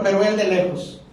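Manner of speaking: ordinary talk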